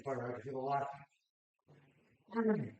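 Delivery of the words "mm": strained grunt mid-lift; grunting through the rep; effort grunt. Real, not acted. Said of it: "sorry for the grunting".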